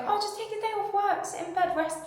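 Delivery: high, sing-song mimicry; airy mock voice of well-meaning people; mocking others' advice. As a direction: parody the breezy advice-givers. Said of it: "when people are like".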